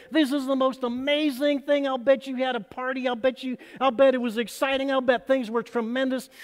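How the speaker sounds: excited aliens. Retold or imitated. Preacher voicing aliens learning who Jesus is, excited. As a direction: gush like an alien who just found the answer. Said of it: "And the aliens got all excited".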